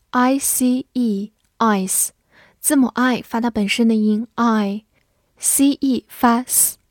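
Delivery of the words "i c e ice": slow, deliberate letters; teacher spelling aloud slowly. Shown as a demonstration. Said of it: "spelling the word letter by letter".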